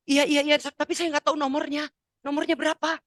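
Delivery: high-pitched, eager asking; flustered excitement. Retold or imitated. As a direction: act out a mother desperate for the number. Flustered mother asking son's number.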